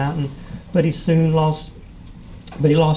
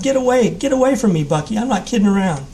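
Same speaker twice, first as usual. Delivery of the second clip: yelling; 6 semitones higher than usual. A narrator's reading. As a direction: shout him away, voice pitched high. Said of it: "Jim yelled".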